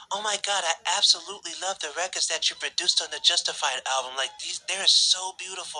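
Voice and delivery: high, gushing pop-star impersonation; imitated star's high praise. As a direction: gush admiringly in a high voice. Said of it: "M J goes".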